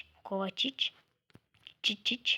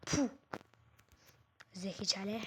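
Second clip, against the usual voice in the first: ordinary delivery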